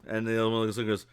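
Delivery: ordinary talk, nothing marked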